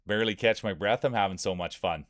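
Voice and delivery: ordinary delivery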